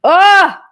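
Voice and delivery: sharp high yelp; real startled shriek. Real, not acted. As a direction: let out a sudden loud yelp. Startled reaction after clip.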